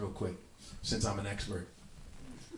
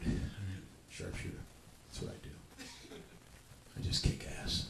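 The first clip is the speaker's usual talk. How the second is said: ordinary talk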